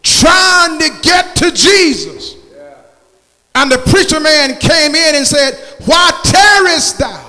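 rising, shouted gospel preaching; fiery preaching. Acted. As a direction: preach it out loud to the church. Preacher at full force.

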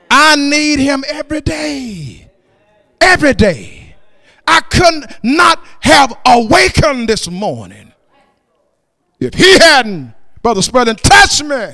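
loud, chanted sermon cadence; rhythmic, fervent pulpit proclamation; full-force preaching. Acted. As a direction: preach it full voice with drawn-out phrase endings.

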